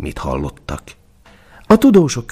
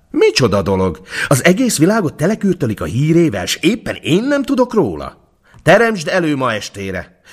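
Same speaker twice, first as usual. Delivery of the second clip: high, put-on imperial voice, indignant; an emperor offended he was never told, voiced higher; 9 semitones higher than usual. A narrator's reading. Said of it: "kiáltotta a császár".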